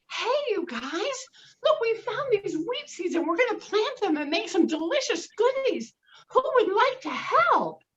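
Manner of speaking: high, loud, thrilled hen voice; high excited hen calling her chicks; excited mother hen. Acted. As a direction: gush like a delighted mother hen. Hen asks friends.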